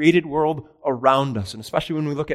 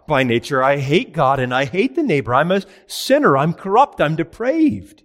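a lightly voiced confessing sinner, higher and louder; mock sinner's confession; 5 semitones higher, 5 dB louder than usual. Preacher voicing the sinner who sees the law, confessing loudly. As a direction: own up to depravity in a raised voice.

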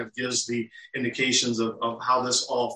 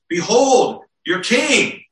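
loud, high proclamation to a crowd; proclaiming loudly; 8 semitones higher, 6 dB louder, slower than usual. Acted. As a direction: announce it to the whole courtyard, higher. Pilate presents Jesus to crowd.

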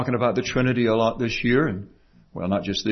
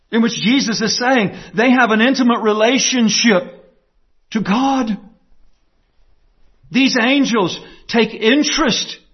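raised pitch with emphatic, punched key words; fervent preaching; 6 semitones higher than usual. Real, not acted. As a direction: preach it with conviction, pausing between weighty phrases.

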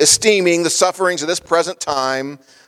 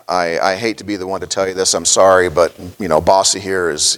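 lightly voiced vet, lower and gently apologetic; vet breaking bad news; 6 semitones lower than usual. Preacher voicing the vet telling the farmer his cow must go, low and gentle. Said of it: "looks at the good old boy and says".

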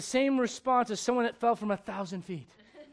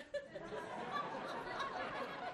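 airy giggle pitched way up; high breathless laugh; 14 dB quieter than usual. Real, not acted. Preacher cracks himself up telling the church, high airy laugh. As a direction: let a high silent laugh escape. Joking about the pregnancy.

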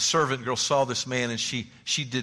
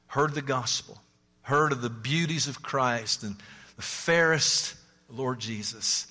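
ordinary delivery

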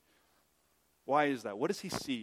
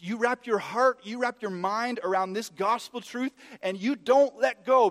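raised, emphatic preaching, slowing to a loud finish; impassioned preaching; 7 semitones higher, 8 dB louder than usual. Acted. Preacher urging listeners to hold the gospel truth, emphatic. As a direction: urge them hard, landing each word.

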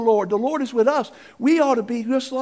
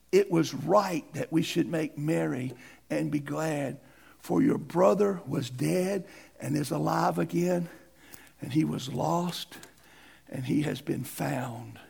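tender scripture reading; 6 semitones lower, 6 dB quieter than usual. Acted. Father's words read tenderly.

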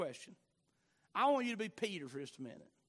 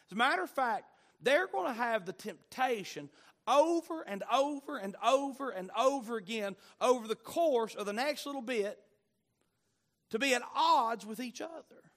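preaching at full force; 3 semitones higher, 4 dB louder than usual. Acted. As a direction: hammer it home from the pulpit. Preacher building intensity.

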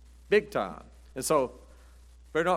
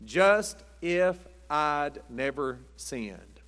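slow saying; much slower than usual. Shown as a demonstration. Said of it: "The old saying is this".

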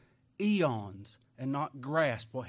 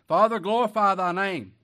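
louder, higher cry to heaven; loud prayer; 6 semitones higher, 12 dB louder than usual. Acted. Jesus crying his prayer to the Father, loud.

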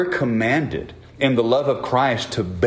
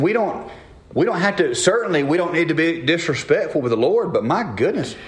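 ordinary talk

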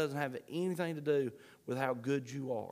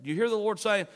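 usual speaking voice

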